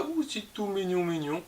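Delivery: high, soft cutesy coo; soft, high-pitched mock adoration; playful cooing. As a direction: coo over something tiny and cute. Cooing over tiny moustaches.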